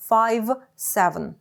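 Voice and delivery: Five, seven with a clearly voiced v at the end of Five and in the middle of seven, a little slow. Shown as a demonstration. Teacher demonstrating voiced v to students, slow and careful. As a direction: keep the v buzzing, don't let it turn to f. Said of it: "не оглушувати звук «в»".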